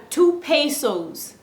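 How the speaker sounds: hammed-up tourist asking for two pesos; playful mock mispronunciation. Shown as a demonstration. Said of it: "We, I".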